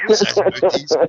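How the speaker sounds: put-on loud, high Scottish kid question; Scottish child's accent. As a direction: mimic a puzzled wee Scottish lassie. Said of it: "sitting there in their Scottish accent".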